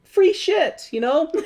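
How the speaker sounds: squeaky high laughing ask; greedy friends. Retold or imitated. The friends asking the Wiz for free stuff, squeaky high. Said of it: "they're like".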